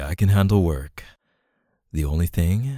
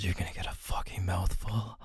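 soft teasing whisper; 9 dB quieter than usual. Acted. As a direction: hiss it under the breath. Intimate roleplay, whispered threat.

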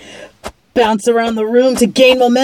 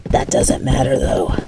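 ordinary talk

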